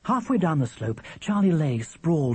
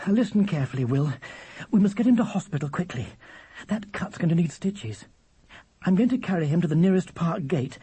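higher-pitched, urgent mother; mother taking charge; 6 semitones higher than usual. Acted. Mother to William after Charlie's bike crash, urgent. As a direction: give firm, pressing instructions.

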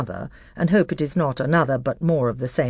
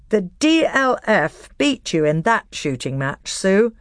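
forced smile; 5 semitones higher, 3 dB louder, slower than usual. Acted. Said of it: "with a slightly forced smile".

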